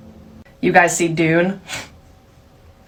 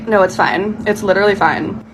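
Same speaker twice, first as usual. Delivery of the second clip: ordinary talk, nothing marked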